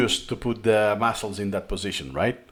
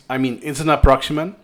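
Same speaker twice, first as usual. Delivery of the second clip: ordinary talk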